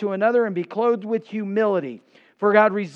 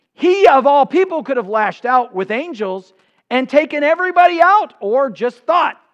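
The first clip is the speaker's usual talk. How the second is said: loud, high preaching climax; rising sermon thunder; preaching at full force; 4 semitones higher, 6 dB louder than usual. Acted. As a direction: build the fire of the sermon, louder and higher. Preacher building to a peak.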